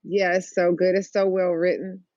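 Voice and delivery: ordinary delivery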